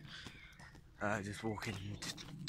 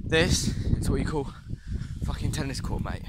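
excited; 5 semitones higher, 9 dB louder than usual. Real, not acted. Excited showing off tennis court.

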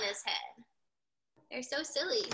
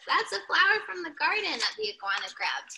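ordinary delivery, nothing marked

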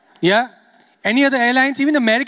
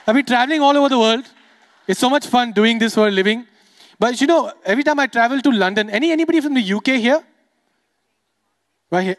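usual speaking voice

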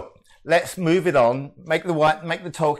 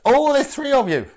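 high, loud surprised oh; startled by the crowd on screen; 10 semitones higher, 6 dB louder than usual. Real, not acted. Host surprised to see three people on camera.

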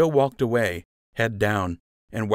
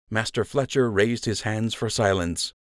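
plain reading voice

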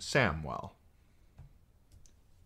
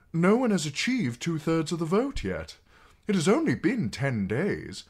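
higher, protesting justification; defensive; 11 semitones higher, 5 dB louder than usual. A narrator's reading. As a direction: defend yourself to the king. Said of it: "in a defensive tone".

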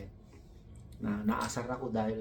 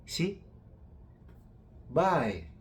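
1 mimicked Bicol "si bye"; 6 semitones higher, 3 dB louder than usual. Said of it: "sabi nga nun sa akin".

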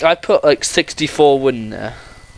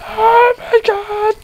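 much higher, slow, squeaked oh my God; squeaky exclamation; much slower than usual. Real, not acted. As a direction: stretch it out in a tiny squeak. Sudden exclamation at the huge house.

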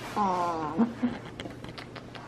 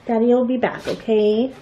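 usual speaking voice

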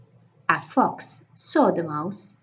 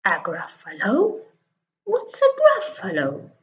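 puzzled fox. Acted. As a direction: squeak out the question in surprise. Fox asks about Gruffalo.

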